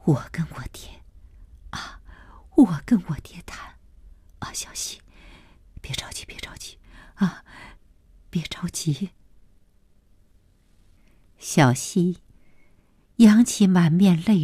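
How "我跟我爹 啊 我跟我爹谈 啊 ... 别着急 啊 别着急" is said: very soft, whispered soothing; hushed reassurance in broken phrases; low murmur. A narrator's reading. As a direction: murmur tenderly, almost without voice. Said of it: "声音低低地说".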